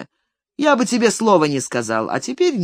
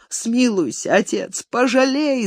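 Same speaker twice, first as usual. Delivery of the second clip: tearful plea; 7 semitones higher than usual. A narrator's reading. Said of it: "заплакал старик".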